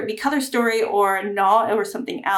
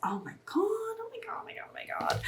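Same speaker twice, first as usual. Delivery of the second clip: breathless whisper of awe; awed whisper; 12 semitones higher, 13 dB quieter than usual. Real, not acted. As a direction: whisper it, stunned by the colour. Awed by palette shade.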